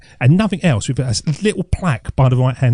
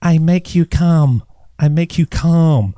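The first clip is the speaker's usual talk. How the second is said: put-on slow Chinese-accented repeat, a little higher; Chinese accent impression; 3 semitones higher, slower than usual. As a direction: lightly imitate her Chinese accent, calmly. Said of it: "so that's what she said".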